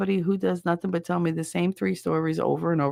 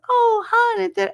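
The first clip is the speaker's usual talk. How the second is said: very high, loud, mock-sugary coo; fake angelic sweetness; 5 dB louder than usual. Mother as her husband walks in, fake sweet. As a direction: imitate mother suddenly turning angel. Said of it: "Angel Marianne appeared, and she was no longer spitting venom".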